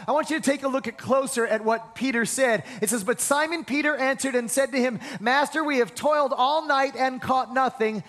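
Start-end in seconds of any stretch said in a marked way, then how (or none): 3.0-8.0 full-force preaching. Acted. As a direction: preach it with fire, building to a shout.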